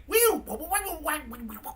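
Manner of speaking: spoken beeps and warbles, high then fading; R2-D2 noises. Acted. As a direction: imitate a chattering little robot. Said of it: "R two D two's like".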